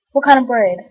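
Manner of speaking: loud, exasperated; loud exasperated question. Retold or imitated. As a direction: ask it loud and fed up. Shouting question at customer.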